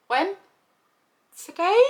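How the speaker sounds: mock mum caught off guard; shocked question. Mum on the phone, high shocked disbelief.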